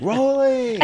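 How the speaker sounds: high-pitched drawn-out call; imitating a yell across a film set; retold yell. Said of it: "I got to yell".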